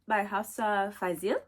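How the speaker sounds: ordinary talk, nothing marked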